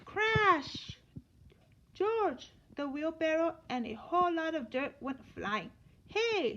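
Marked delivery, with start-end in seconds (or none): 0.0-1.0 high, loud "Crash!" sound effect. Acted. Narrator voices the toppling wheelbarrow with a loud crash. 1.9-2.5 high, loud call of the name. Acted. Man with the yellow hat shouts for George, loud call. 6.1-6.6 high, loud "Hey!"; cried out. Acted. A digger cries out at the monkey, loud protest. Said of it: "someone cried".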